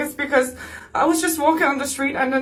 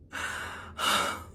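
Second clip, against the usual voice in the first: soft, heavy sigh; upset sigh; 12 dB quieter than usual. Real, not acted. Shaken victim pausing after telling of being spat on, upset sigh. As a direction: let out a real sigh of distress.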